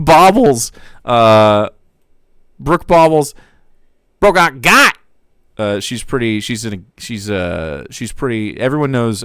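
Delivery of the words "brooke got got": louder, higher taunting chat line; a louder, higher mock taunt; gleeful chatter. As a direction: gloat it like a viewer. Reading a chat message.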